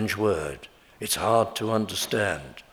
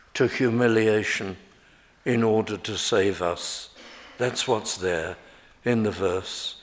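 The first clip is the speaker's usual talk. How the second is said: ordinary talk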